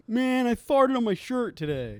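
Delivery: high, slow goofy dude; dumb dude voice. Retold or imitated. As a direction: play a dopey vlogger. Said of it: "somebody that was like".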